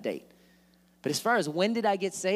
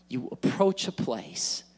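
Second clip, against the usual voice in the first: normal talking voice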